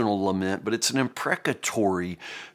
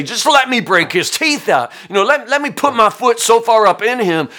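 mock vengeful David; 11 semitones higher, 9 dB louder than usual. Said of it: "David doesn't say".

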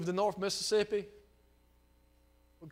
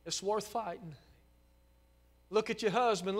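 ordinary talk, nothing marked

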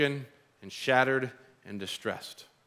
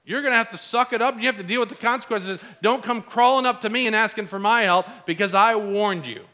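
higher, louder, dismissive scolding; smug told-you-so; 7 semitones higher, 4 dB louder than usual. Retold or imitated. Preacher mocking a smug friend, louder told-you-so. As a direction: brush off someone who ignored your warning. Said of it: "We're like".

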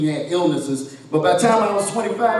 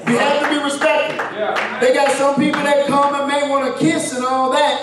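preaching; 7 semitones higher than usual. Acted. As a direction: preach it out with rising fervor. Preaching at full force.

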